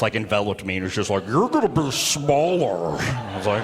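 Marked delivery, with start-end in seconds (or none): 1.3-3.0 slow, drawn-out bear impression. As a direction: stretch it out like an enveloping bear. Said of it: "it just felt like a bear".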